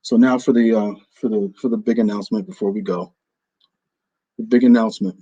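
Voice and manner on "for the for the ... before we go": ordinary talk, nothing marked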